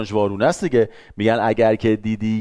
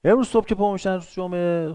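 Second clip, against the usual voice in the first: ordinary talk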